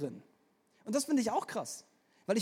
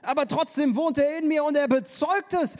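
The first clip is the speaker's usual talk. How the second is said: higher and louder preaching build; rising preaching; 6 semitones higher, 7 dB louder than usual. Real, not acted. Preacher assuring the congregation, raised and fervent. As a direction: drive it home with fervour.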